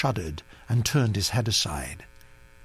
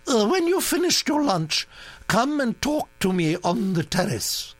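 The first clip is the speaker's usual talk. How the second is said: commanding duchess; 10 semitones higher than usual. Acted. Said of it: "ordered the Duchess".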